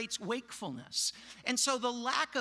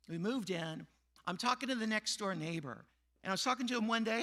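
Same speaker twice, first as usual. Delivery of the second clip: ordinary talk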